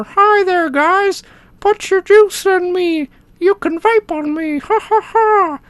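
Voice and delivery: very high cartoon tank voice; squeaky talking-toy character; silly clown. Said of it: "see the little smiley face".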